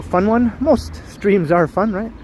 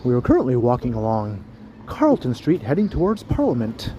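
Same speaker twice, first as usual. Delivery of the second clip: tour guide voice; 2 semitones lower, 4 dB quieter than usual. Acted. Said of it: "Put on my tour guide voice".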